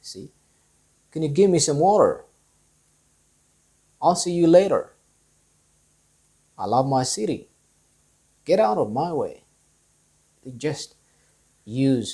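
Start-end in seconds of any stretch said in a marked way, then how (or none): 1.1-9.4 quick, every middle T as a light D; American flap T. Shown as a demonstration. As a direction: run the sentences with flapped Ts. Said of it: "the light D sound".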